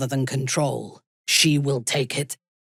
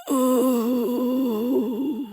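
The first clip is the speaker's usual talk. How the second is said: high, strained cry of pain; 8 semitones higher than usual. Acted. Warrior cries out in pain when struck. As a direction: cry out as the blow lands.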